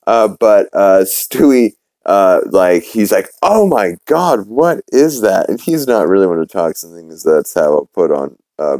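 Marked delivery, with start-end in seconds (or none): 3.4-5.5 slow, higher-pitched mock horror; imitating appalled baby. Baby reacting to a girl's terrible accent, drawn-out dismay. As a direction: stretch it out, aghast and higher.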